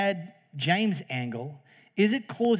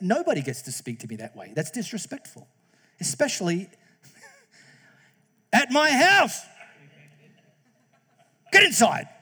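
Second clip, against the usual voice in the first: firm scolding; 4 semitones higher, 4 dB louder than usual. Retold or imitated. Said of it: "And I said".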